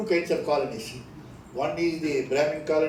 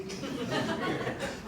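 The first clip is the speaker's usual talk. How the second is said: quiet laugh; 7 dB quieter than usual. Real, not acted. Laughing after Paris question.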